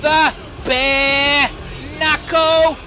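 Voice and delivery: mock wrestling announcer reading the lineup; ring announcer. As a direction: stretch the names out for the crowd. Announces wrestlers in arena style.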